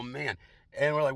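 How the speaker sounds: ordinary delivery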